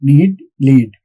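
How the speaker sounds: "Need, lead" as a demonstrated rhyme; "lead" spoken to rhyme exactly with "Need"; long ee rhyme. Shown as a demonstration. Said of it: "rhymes with the word need".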